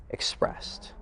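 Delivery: breathy whisper on expressed. Shown as a demonstration. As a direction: let expressed slip out as a whisper. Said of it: "allow the syllables to be".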